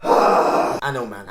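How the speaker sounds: bummed sigh. Real, not acted. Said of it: "I was a little bummed out too".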